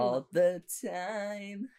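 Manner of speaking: slow, high, drawn-out swoon; swooning echo. Retold or imitated. Co-host echoing a favourite cute line, drawn-out swoony voice. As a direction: stretch each word dreamily upward.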